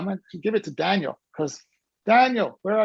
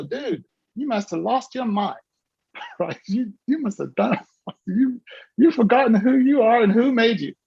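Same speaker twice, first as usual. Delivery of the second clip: usual speaking voice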